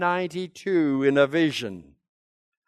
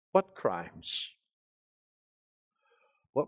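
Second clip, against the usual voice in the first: much lower and softer, playing the puzzled people; defensive people; 12 semitones lower, 10 dB quieter, slower than usual. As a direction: mutter it back like the accused crowd. People answering the prophet.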